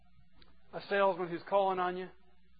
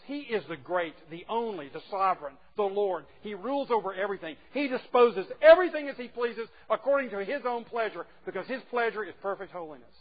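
each title louder and higher; preaching crescendo; 4 semitones higher, 9 dB louder than usual. Acted. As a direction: build to a booming peak of praise. Preaching builds to climax.

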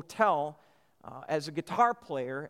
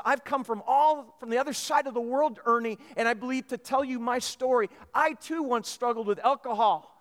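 higher-pitched, heartfelt retold testimony; earnest testimony; 5 semitones higher, 3 dB louder than usual. Bill tells Ernie his story of alcohol, earnest testimony. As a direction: retell it as an urgent personal confession. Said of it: "And, and Bill said".

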